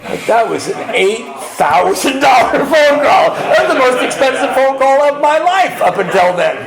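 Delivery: high, shouted comic complaint; exasperated punchline outburst. Acted. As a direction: cry out the cost in mock outrage. Exasperated punchline about costly phone call.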